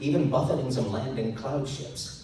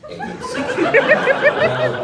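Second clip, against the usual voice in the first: words broken by high laughter; laughing through words; 12 dB louder, much slower than usual. Real, not acted. Narrator laughing while reading the narration. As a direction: read it while cracking up.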